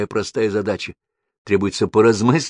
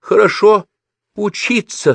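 high, emphatic answer; insistent mother, word by word; emphatic demand; 12 semitones higher, 5 dB louder than usual. A narrator's reading. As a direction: lay down the demand, pitch raised. Mother answers her own question.

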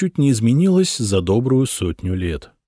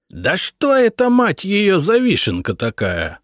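much higher, loud astonished outburst; loud surprise; 10 semitones higher than usual. A narrator's reading. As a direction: blurt it out in disbelief. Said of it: "Громко удивилась Гудрун".

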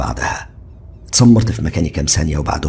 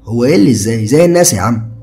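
higher, louder easygoing friend; carefree young man, higher and louder; Ziad's casual voice; 7 semitones higher, 6 dB louder than usual. A narrator's reading. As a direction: toss it off lightly like a carefree buddy. Ziad chatting about jacuzzi.

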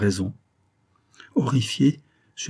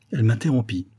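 plain reading voice

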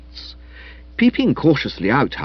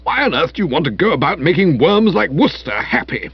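Spode's voice; 3 semitones higher than usual. A narrator's reading.

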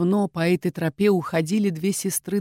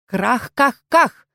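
slow, rasping bird-like cackle; creature croak; 6 semitones higher, 5 dB louder, much slower than usual. A narrator's reading. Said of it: "выдал Мифик".

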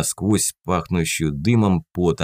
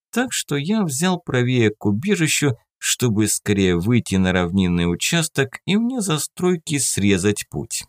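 ordinary delivery

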